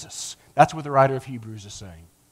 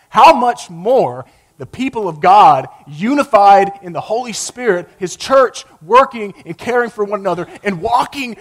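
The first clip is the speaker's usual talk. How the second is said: rising, louder preaching build; 4 semitones higher, 6 dB louder than usual. Acted. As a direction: build it up like a preacher at full force. Preaching climax on unity.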